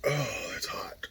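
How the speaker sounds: strained whisper from the heat; mouth burning whisper. Real, not acted. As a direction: breathe it out, barely voiced, burning. Whispered after spicy bite.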